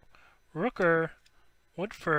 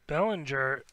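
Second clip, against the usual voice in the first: ordinary talk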